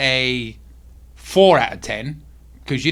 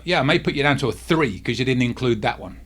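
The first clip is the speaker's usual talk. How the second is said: ordinary delivery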